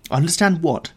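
irritated demand for an explanation; angry. A narrator's reading. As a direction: snap back with restrained anger.

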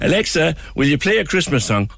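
high, bright Cork lilt asking Alexa; sing-song Cork voice talking to Alexa; mock Cork accent on a smart speaker command; Cork accent request. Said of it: "Cork Alexa".

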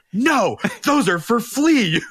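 hammed-up shout, half laughing; fake yell. Host fake-yelling at his guest, mock shout with laughter. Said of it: "he fake yells at me".

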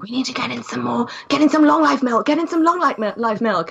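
fussy parent voice. Retold or imitated. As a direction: mimic anxious parents stocking up. Parents voiced fretting about milk.

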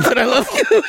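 high mock goodbye through laughter; mock farewell call. Passenger calling loved one, laughing mock farewell. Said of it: "calls from, like, the nine eleven hijack plane".

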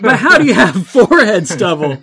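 loud, incredulous laugh; laughing aside to the listener. Real, not acted. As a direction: break character laughing.

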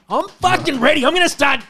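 high, loud hype; pumped up. Pretend. Boasting to mates he's due a fight, high and loud. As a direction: psych yourself up for the fight. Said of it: "I'm ready".

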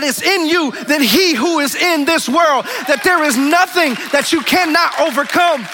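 loud, high, fervent preaching; full-throated revival sermon, pleading and soaring; preaching at full force. Acted. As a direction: build the congregation up with passion.

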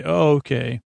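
ordinary talk

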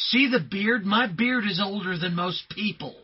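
mock-indignant loud protest; exasperated retort. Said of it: "I'm like".

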